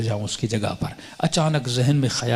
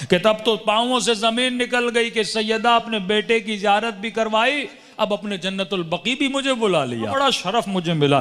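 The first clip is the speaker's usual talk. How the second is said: higher, impassioned sermon climb; fervent preaching; 9 semitones higher than usual. Acted. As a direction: lift the congregation with fervour. Rising preaching fervour.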